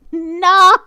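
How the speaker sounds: loud, drawn-out mock cry of no; mock horrified protest. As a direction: wail it in playful horror. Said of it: "I'm like, "No!".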